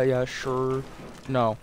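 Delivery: usual speaking voice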